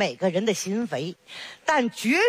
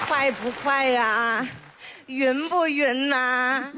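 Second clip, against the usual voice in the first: slow teasing; 3 semitones higher, much slower than usual. Acted. Grandma teasing baby on a ride.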